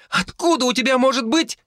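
put-on high, panicked child's cry; scared shrill question; frightened girl. A narrator's reading. Girl in terror continues.

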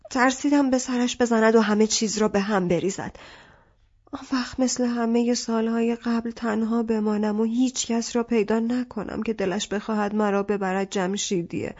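ordinary delivery

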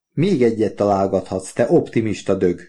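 usual speaking voice

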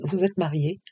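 plain reading voice

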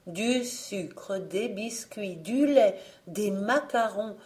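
plain reading voice

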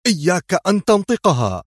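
high, sharp forbidding cry; frantic warning, pitched well up; screamed warning. A narrator's reading. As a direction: cut them off with a high outcry. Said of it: "صرخت به قبل أن يتم قوله".

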